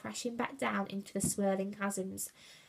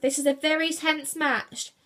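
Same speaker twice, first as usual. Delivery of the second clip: loud, mildly irritated protest; growl; 5 semitones higher, 5 dB louder than usual. A narrator's reading. Brutus shooing away his brother, growled. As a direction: growl it, absorbed in the game. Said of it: "he growled".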